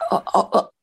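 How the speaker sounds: stammering, reluctant okay; hesitant stammer. Retold or imitated. Agent agreeing to watch her impressions, hesitant stammer. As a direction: stumble on it, unsure, ending on a questioning rise.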